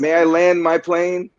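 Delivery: slow it down and insist on being heard; insistent plea to finish. Real, not acted. Pushing through interruptions to finish.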